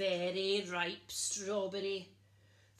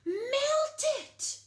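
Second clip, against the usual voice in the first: much higher, louder shriek; furious command; 10 dB louder than usual. A narrator's reading. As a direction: shriek the order. Princess orders jelly melted.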